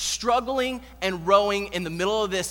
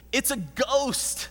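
slow, higher, understated cry of fear; mild acted dread; fearful cry; 4 semitones higher, much slower than usual. A narrator's reading. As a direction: read it as a stretched-out frightened cry. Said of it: "and cried out in fear".

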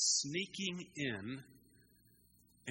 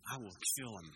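low, soft vow of revenge; understated anger, voice dropped low and quiet; quietly upset; 5 semitones lower, 6 dB quieter, faster than usual. Acted. Wronged son vowing to kill his brother, quietly upset. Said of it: "That's how upset he was".

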